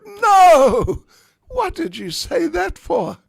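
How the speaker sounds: very high, loud dismayed shriek; panicked cry. Acted. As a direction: squeal it out in panic. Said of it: "Radharani thought".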